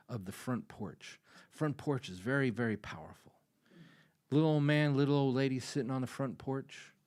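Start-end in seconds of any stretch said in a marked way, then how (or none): none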